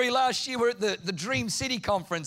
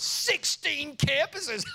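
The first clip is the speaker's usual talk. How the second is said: higher, slow and shouted with a laugh; bold challenge; 7 semitones higher, much slower than usual. Retold or imitated. Pastor daring God with a big request, laughing. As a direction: throw it out as a dare. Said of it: "I wrote on there".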